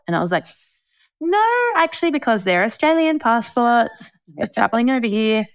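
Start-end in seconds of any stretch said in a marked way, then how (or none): 1.2-5.5 singsong high, overly nice reply; killing with kindness. Retold or imitated. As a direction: correct them with exaggerated sweetness. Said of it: "kill them with kindness".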